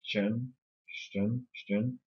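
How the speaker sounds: "chen" unstressed, with a weak neutral vowel; weak syllable. Shown as a demonstration. Teacher showing a weak syllable, "chen" left unstressed. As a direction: let "chen" fall weak and reduced. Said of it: "c'est une syllabe qui est faible".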